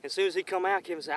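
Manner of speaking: ordinary talk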